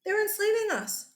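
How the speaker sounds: high, whiny protest; the Matamba whining to Portugal, high and hurt; complaining to an ally. Retold or imitated. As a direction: plead with a friend who let you down. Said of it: "The Matamba go to Portugal and say".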